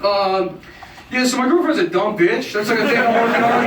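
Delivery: lampoon a smug open-mic comic; mocking comic boyfriend. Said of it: "they show up at the open mics and they're like".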